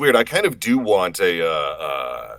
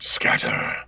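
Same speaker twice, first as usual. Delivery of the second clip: much lower, breathy dark; Omen voice line; 9 semitones lower than usual. Acted. Giving chat their favourite line, low breathy. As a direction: breathe the word darkly. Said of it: "Everyone loves scatter".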